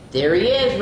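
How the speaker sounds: acted cry of discovery; storybook shout. A narrator's reading. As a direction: shout it like a bird spotting danger. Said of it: "Rosie shouted".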